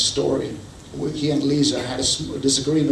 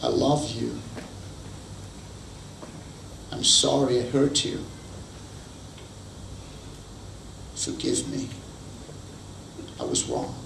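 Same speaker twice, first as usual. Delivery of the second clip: sincere apology; 7 dB quieter than usual. Shown as a demonstration. Preacher modelling an apology for spouses, soft and sincere. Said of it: "these are the words you should learn".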